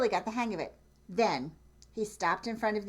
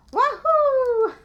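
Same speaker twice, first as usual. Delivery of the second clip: very high, loud whoop; joyful whoop; 13 dB louder than usual. Acted. Duck cheering on the road, a very high loud whoop. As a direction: whoop with glee. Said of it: "what he thought".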